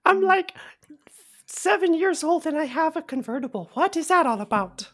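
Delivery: high-pitched small character; little guy voice. Acted. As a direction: wonder aloud as a tiny fellow. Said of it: "your best little guy voice".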